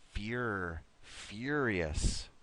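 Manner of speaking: "Furious" built up from a drawn-out first syllable FYUR; sound demo. Shown as a demonstration. Teacher shows a different sound, slow careful demo of "Furious". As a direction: isolate "fyur", then say the whole "Furious" carefully. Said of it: "a little bit different sound in this word".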